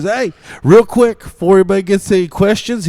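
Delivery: high, loud, urgent; coach announcement. Retold or imitated. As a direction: grab their attention quickly. Coach speech.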